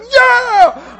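a high, loud yeah; joyful shout. Retold or imitated. Preacher reliving his run, shouting yeah. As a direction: throw it out with delight.

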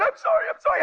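high, pleading repeated sorry; pleading apology. Retold or imitated. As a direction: beg forgiveness, voice way up. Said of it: "She's going".

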